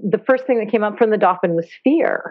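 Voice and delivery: ordinary talk, nothing marked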